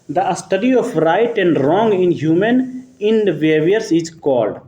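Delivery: reads the question out very slowly, word by word; slow dictation. Shown as a demonstration. Teacher slowly reads the quiz question to students.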